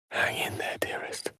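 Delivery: soft tender whisper. Acted. Wizard comforting a fallen companion, whispered. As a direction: breathe it close to a wounded friend.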